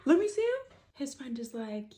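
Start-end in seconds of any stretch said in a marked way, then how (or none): 0.1-0.8 high, loud retold demand. Friend on the call demanding to see him, loud. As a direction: shout it at the phone. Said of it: "And he goes".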